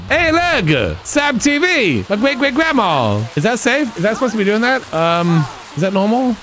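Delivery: hammed-up fretting over danger; lightly acted nervous questions; mock alarm. Voicing sim seeing the skeleton.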